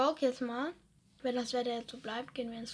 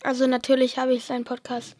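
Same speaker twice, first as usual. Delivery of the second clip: much louder announcer push; loud announcement; 12 dB louder than usual. Pretend. Friend told to plug Instagram, loud announcement. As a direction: broadcast it loudly to the listeners. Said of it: "dass sie mir alle auf Insta folgen sollen".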